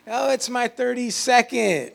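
high, drawn-out mock excitement; milk it in a high, slow voice; mock birthday boasting. Preacher teasing people who celebrate every birthday, high mock excitement.

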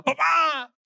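put-on high, frightened shout of a little boy; child's scared yell. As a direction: cry out for dad like a scared kid. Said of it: "otra vez me gritaba". (grito asustado de niño pequeño)

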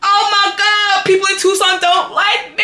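shocked realization. Retold or imitated. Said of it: "I was just like".

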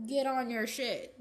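ordinary delivery

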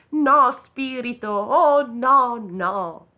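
high, slow, pleading no; desperate pleading. Acted. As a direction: beg the spirit, slowly and desperately. Scrooge pleads before the tombstone.

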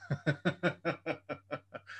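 amused laugh. Real, not acted. Owner relishing a rival's bad reviews, amused laugh. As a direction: chuckle steadily to yourself, falling away.

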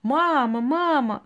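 put-on high excited child calling mama. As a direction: call out like a scared kid. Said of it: "украинский анекдот, когда ребёнок приходит". (детский крик, зовёт маму)

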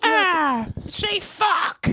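high laughing mock hype man. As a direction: ham up a cheesy stage shout. Mocking a band's crowd calls.

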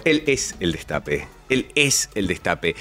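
slow, low announcer catchphrase; Roberto Navarro. Retold or imitated. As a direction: drag it out like a radio slogan. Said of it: "como dice el señor Roberto Navarro".

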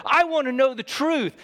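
high, loud courtroom yell; retold courtroom shout; yelling lawyer. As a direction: shout the demand at the witness. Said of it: "He says".

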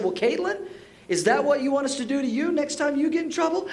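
put-on high-pitched, leading question to a child; pointed parental question. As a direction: imitate a parent turning the tables.

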